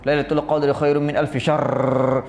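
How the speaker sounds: put-on slow, careful recitation with a Chinese accent and no rolled r; hammed-up Chinese-accented Quran chant. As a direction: recite slowly like a Chinese worshipper struggling with Arabic. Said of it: "Pasal apa yang baca teruk sangat ni?".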